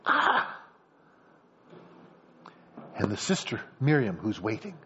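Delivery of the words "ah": high, breathy "ah" of surprise; mock startled exclamation; short gasp. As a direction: let out a sharp acted gasp. Reaction to finding the baby.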